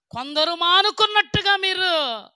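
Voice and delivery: loud preacher's rebuke; fiery preaching. Acted. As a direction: call out the congregation from the pulpit. Preaching at full force.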